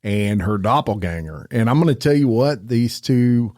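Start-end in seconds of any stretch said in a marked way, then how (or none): none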